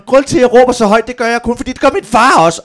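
put-on high, loud boy's declaration; Valdemar. Son blaming dad before therapist, loud and high. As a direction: imitate a child loudly telling on dad. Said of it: "Og så siger Valdemar".

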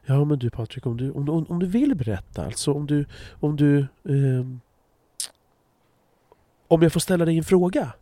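high, hesitant, tiptoeing politeness; mock overly careful. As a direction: stammer out the question as if afraid to offend. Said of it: "folk säga till varandra så här".

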